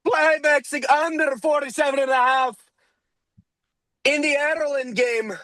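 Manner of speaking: a pub-shouting Irishman with a hammed-up accent; shouted Irish accent. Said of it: "talk like that".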